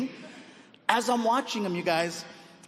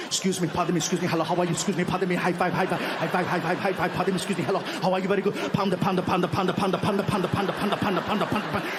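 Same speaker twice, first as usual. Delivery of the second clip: put-on breakneck Arnold patter in accent; rapid-fire greetings; much faster than usual. Arnold hurried down the line by handler, rapid-fire patter. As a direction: rattle it off nonstop, barely keeping up. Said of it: "He is hitting Arnold so fast".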